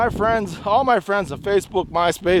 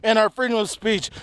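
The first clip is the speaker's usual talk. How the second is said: normal talking voice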